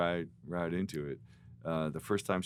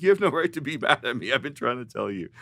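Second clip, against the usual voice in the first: indignant friend; 7 semitones higher, 9 dB louder, faster than usual. Retold or imitated. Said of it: "He was like".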